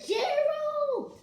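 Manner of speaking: high excited call of a name. Acted. Piggie calling out to Gerald, loud and high. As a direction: shout the friend's name across the yard. Kid reading Elephant and Piggie aloud.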